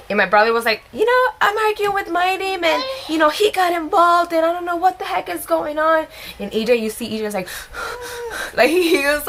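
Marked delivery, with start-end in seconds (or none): 0.9-6.1 high-pitched retold complaint; mock a worked-up brother pleading his case; high, agitated imitation; imitating upset brother. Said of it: "crying, and my parents showed up". 7.5-8.5 imitated heavy, worked-up breath; mock heaving breath. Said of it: "breathing so heavily".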